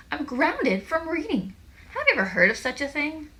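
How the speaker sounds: outraged disbelief. A narrator's reading. Squeezy tells friends of being grounded from reading, outraged. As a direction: stretch the complaint up high.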